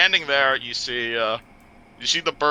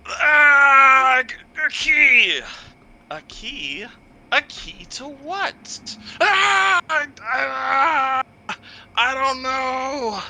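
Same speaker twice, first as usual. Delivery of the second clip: terrified crying; 13 semitones higher, 5 dB louder, much slower than usual. Acted. Captive's mind drilled, crying out.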